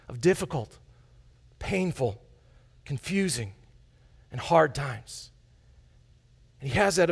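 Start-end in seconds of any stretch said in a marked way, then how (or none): 0.1-5.3 quiet, slow list. Shown as a demonstration. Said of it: "slow soft listing".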